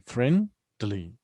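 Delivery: "Friendly" broken into friend, ly; two separate syllables. Shown as a demonstration. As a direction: count out both syllables of "friendly". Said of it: "we have two syllables here".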